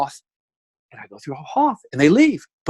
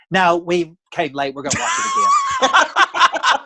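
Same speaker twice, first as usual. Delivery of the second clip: laughing, high, loud Southern mother's retort; Southern accent; 12 semitones higher, 3 dB louder, slower than usual. Retold or imitated. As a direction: tell off the usher in a loud Southern twang through laughter. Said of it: "that Southern".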